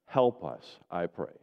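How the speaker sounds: prayer. Real, not acted. Pastor praying over the congregation to believe, earnest. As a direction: pray it humbly to God.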